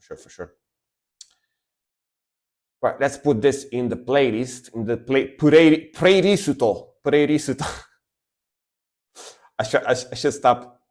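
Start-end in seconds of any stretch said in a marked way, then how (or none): 5.4-7.7 put-on English loanword said with Japanese accent. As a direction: playlist said the Japanese way. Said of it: "I should stop doing the, the Japanese accent".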